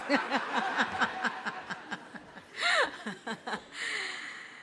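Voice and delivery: long giggling laugh; high laugh. Real, not acted. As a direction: laugh at your own joke. Host laughs at own joke.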